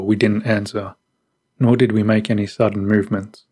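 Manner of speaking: ordinary delivery, nothing marked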